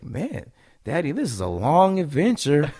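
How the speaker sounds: imitated young son. Young son to his dad on a night hike, imitated child voice. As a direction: play a little kid calling to his dad, amused.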